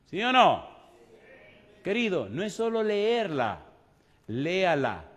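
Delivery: loud, rising preacher's appeal; fiery preaching. Acted. Preacher exhorts his congregation to live the word, loud and fervent. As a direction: press the congregation with conviction.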